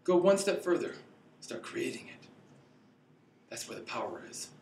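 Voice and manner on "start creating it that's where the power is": breathy intimate whisper. Real, not acted. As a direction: lean in and whisper it.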